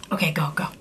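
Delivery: hushed whisper urging. Acted. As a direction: whisper him on to go. Whispering to start the story.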